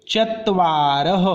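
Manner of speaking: चत्वारः said very slowly, ending in a breathy visarga "ह"; slow visarga demo. Shown as a demonstration. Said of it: "इस शब्द का उच्चारण कैसा करना है आपको?". (चत्वारः को धीरे-धीरे, अंत में विसर्ग का हल्का "ह" बोलकर)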